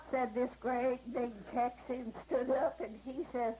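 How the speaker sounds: usual speaking voice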